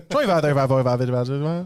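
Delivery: rapid auctioneer patter. Pretend. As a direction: call the bids like an auctioneer. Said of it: "Like an auctioneer over here".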